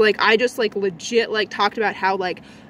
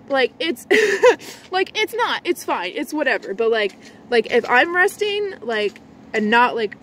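ordinary delivery

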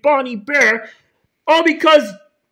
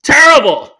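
loud, exasperated exclamation; angry outburst; 2 semitones higher, 7 dB louder than usual. Acted. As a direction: burst out loudly to the parent. Principal answers how he is.

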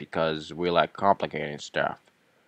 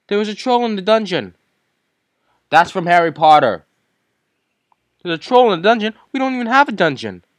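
much higher, fast panicked cry; panicked announcer; 13 semitones higher, 8 dB louder, faster than usual. Acted. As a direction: shout the alarm in a shrill panic. Panicked troll alarm, Harry Potter quote.

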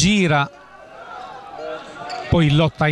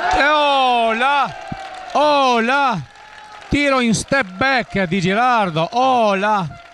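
high, slow, drawn-out exclamations; excited commentator cry; 6 semitones higher, slower than usual. Real, not acted. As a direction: cry out at the shot. Commentator reacts to a big play.

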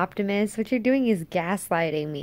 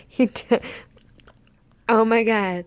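normal talking voice

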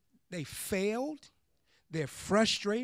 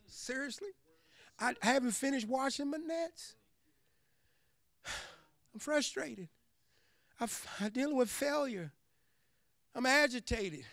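higher, softer weary grumble; grumbling Peter; 4 semitones higher, 9 dB quieter than usual. Retold or imitated. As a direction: sulk like a tired fisherman muttering complaints. Peter complains to Jesus.

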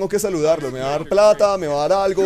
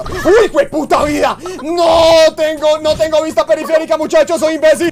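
real furious yelling, much higher and louder; furious shout; 11 semitones higher, 9 dB louder than usual. Real, not acted. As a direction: explode in genuine rage at the game, dragging the words. Raging at a game loss.

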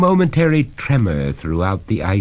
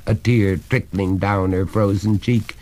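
plain reading voice

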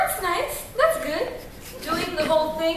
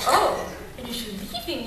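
ordinary talk, nothing marked

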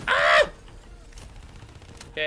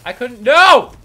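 loud, higher cry of no; panicked yell; 6 semitones higher, 17 dB louder, slower than usual. Real, not acted. Player dying in the game, yelling in panic. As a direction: yell as it all goes wrong.

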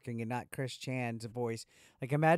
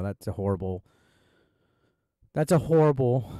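ordinary talk

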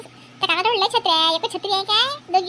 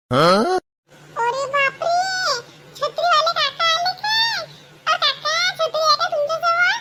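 put-on high, childlike and eager, slower at times; little girl voice; 8 semitones higher, much slower than usual. Little girl asking the umbrella uncle for a doll umbrella, high childish voice. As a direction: play an excited little girl asking about pink umbrellas.

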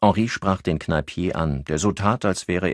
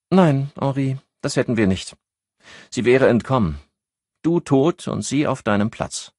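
Annette, higher voice; 9 semitones higher, 4 dB louder than usual. A narrator's reading.

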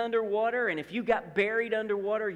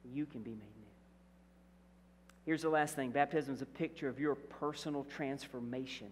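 ordinary talk

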